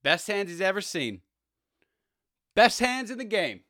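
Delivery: sneering at a receiver dropping passes; mocking praise. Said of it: "I was just thinking, like".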